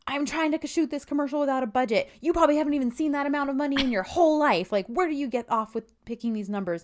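mildly acted indignant rant, starting high; flipping out. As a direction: play exasperated outrage at a haggler. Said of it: "she flips out again".

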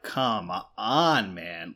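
low, deflated "come on, man"; exasperated groan. Retold or imitated. Radiologist grumbling at a single blurry photo, exasperated. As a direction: sag into it, fed up. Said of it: "and you're like".

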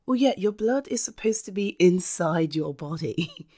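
ordinary delivery

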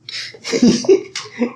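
breathy laugh. Real, not acted. Laughing at the dog.

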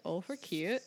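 light Minnesota accent impression. Transplant quoting what locals all say, in a mock Minnesota accent. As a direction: lightly imitate a Minnesotan stock phrase. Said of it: "And they all say".

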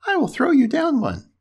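high-pitched piglet; obliging little pig. A narrator's reading. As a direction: offer in a high storybook pig voice. Pig answers from tree.

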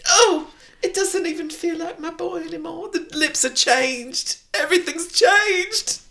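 slow, very high comic wail; hammed-up grief. Mother after kissing her changed boy, high mock-tragic wail. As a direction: draw out a melodramatic lament.

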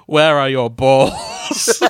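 slow, loud teasing question; deadpan ask. Pretend. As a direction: drag it out as a mock challenge. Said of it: "you just turn to them and go".

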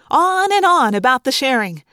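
ordinary delivery

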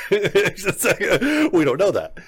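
talking through a laugh; amused, laugh breaking into the words. Real, not acted. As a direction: chuckle your way through the retold quote. Laughs while retelling a creepy quote.

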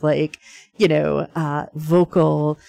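ordinary delivery

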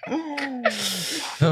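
high, slow laughing verdict. Real, not acted. Player judging the accents, slow and laughing. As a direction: drag it out laughing.

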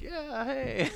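high-pitched half-hearted hello back; playing along. Answering people who call him Rudy, playing along. Acts his reply to mistaken greeting.

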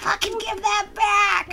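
high, drawn-out mock scream. Host demanding the can back, mock screaming. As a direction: screech it playfully, without full volume. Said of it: "I'm fucking screaming at you".

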